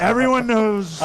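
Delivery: high, slow drawn-out impression; Donald Trump. Candidate backing up his insult to the rival, high slow impression. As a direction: stretch it out high and certain. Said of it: "how to do his voice".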